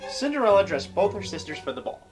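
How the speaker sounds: ordinary talk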